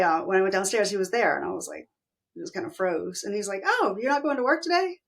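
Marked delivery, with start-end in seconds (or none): 3.7-5.0 mock cheerful. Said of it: "Just happy".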